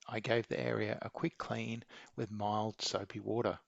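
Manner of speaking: ordinary talk, nothing marked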